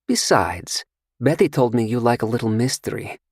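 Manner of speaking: coy and sure of herself; seductive stranger. Acted. Seductive stranger asks Carter about his drink, coy. Female character voice.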